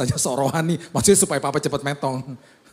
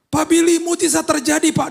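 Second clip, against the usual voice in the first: much higher and louder, almost singing; overjoyed caller; 8 dB louder than usual. Retold or imitated. Caller shouting the miracle news to the pastor, overjoyed. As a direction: burst out with the news like a song.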